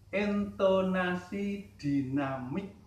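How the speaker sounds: "intonasi dinamik" said slowly, each syllable drawn out; slow repeat. Shown as a demonstration. Teacher repeating the term for students, slow and deliberate. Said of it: "Saya ulangi".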